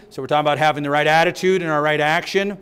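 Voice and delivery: ordinary talk, nothing marked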